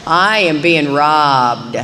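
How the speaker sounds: slow, drawn-out mock lament; mock self-pity. Missing her TV show for a crying sponsee, mock self-pity. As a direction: wail it slowly, as a hammed-up victim.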